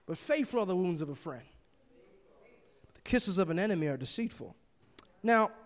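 ordinary talk

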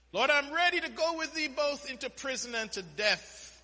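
high-pitched, declamatory pledge; boastful vow. Retold or imitated. Preacher plays Peter pledging himself to the Lord, boastful vow. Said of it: "we, we boast".

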